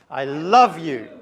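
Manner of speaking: loud shouted declaration; loud shout of love. Shown as a demonstration. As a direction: yell it across the room. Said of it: "shouting it".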